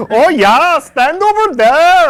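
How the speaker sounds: high, slow put-on Swedish accent; bad Swedish accent. Film crew directing elephants in a hammed-up Swedish accent. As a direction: ham up a Swedish accent, drawing out the words. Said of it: "that is way worse than my".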